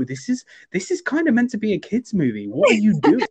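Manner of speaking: acted-out shocked viewer; appalled disbelief.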